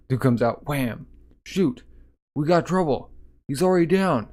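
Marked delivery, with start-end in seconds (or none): 1.5-4.3 startled shouting as trouble breaks out; alarmed guards. Acted. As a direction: sound the alert to the other guards.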